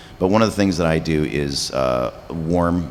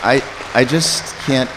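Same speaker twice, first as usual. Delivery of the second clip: higher, slow, voice catching with emotion; choked up; 10 semitones higher, 5 dB louder than usual. Real, not acted. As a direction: struggle to get the words out. Overwhelmed thanking audience.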